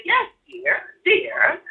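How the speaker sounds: imitating dad soothing mom; placating husband. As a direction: settle her down sweetly. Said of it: "my dad said".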